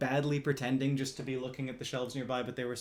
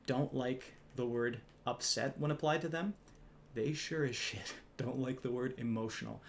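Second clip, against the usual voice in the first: normal talking voice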